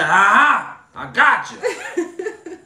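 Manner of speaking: high, very slow taunting sing-song; gloating inspector. Retold or imitated. Inspector catching the tree crew, taunting in a high slow voice. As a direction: stretch it out like a gloating gotcha. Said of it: "He said".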